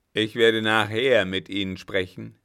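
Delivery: loud, threatening parting shout; calling after him. A narrator's reading. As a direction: call the warning after him. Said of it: "rief ihm der Alte nach". (laut und drohend nachgerufen)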